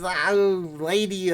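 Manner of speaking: high goofy comedian voice; Jerry Lewis. Acted. Streamer hunting for the impression, high Jerry Lewis voice. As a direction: call out in a high comic whine. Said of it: "do a Jerry Lewis impression".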